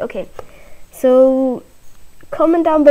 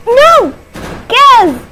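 high frantic shout of dismay; panicked yell; 12 semitones higher, 5 dB louder than usual. Real, not acted. Gamer yelling as thieves take the gas, panicked shout. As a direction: yell it like your gas is being stolen.

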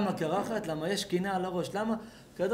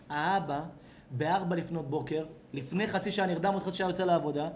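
ordinary delivery

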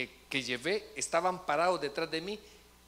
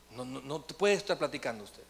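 ordinary talk, nothing marked

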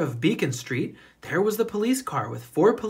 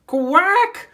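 sudden high, loud duck quack; startled quack; 8 dB louder than usual. A narrator's reading. Missus Mallard startled by speeding cars, a loud high quack. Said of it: "went Missus Mallard as she tumbled back".

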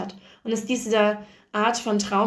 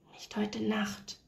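soft, slow whisper into the dark; 10 dB quieter, slower than usual. A narrator's reading. Nurse whispering to death in the dim room, whispered. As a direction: breathe it out without voice, a quiet plea. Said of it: "flüsterte ich in die Dämmerung".

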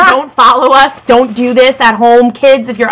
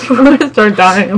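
laughing. Real, not acted. Laughing with mouth full.

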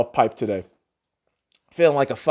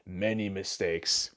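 soft echo; 6 semitones lower, 11 dB quieter, slower than usual. Shown as a demonstration. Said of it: "saying that from experience".

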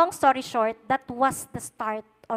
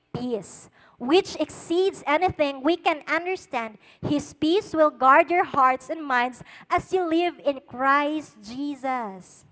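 scripture reading; 5 dB louder, slower than usual. Shown as a demonstration. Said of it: "it says there".

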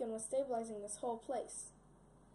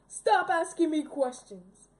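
loud, slow, exasperated order; irritated villain; 4 semitones higher, 13 dB louder, slower than usual. Acted. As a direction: snap shut the questioning. Villain annoyed by prisoner.

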